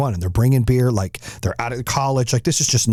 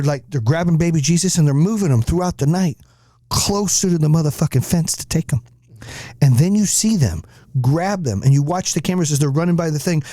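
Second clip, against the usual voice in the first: usual speaking voice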